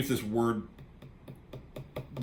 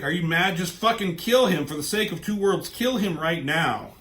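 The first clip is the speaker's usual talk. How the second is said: mock angry demand; 6 semitones higher, 5 dB louder than usual. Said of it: "And she's like".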